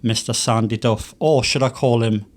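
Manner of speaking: normal talking voice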